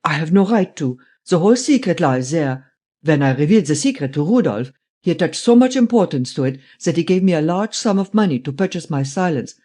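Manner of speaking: put-on emphatic German-accented old man; old German man, insistent. Voices old German Steinweg, refusing.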